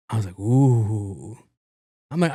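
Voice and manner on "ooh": long, low drawn-out ooh; mock-scared "ooh". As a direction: draw out a taunting ooh. Mock reaction to the threat.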